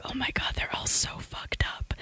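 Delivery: hushed whisper of a private thought. Retold or imitated. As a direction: breathe it out under your breath. Whispered inner thought quote.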